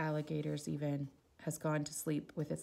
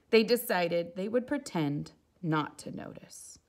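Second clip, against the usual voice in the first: ordinary delivery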